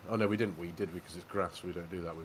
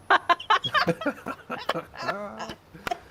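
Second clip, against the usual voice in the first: loud, high burst of laughter; real laugh; 13 semitones higher, 5 dB louder than usual. Real, not acted. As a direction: crack up hard at the pun. Punchline of his own joke.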